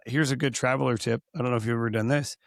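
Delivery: ordinary talk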